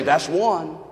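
low, soft deadpan warning; cold quiet warning. Retold or imitated.

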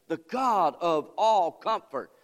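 slow, loud, measured; slow preaching. Acted. Preacher repeating the phrase, slow and loud. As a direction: weigh each word.

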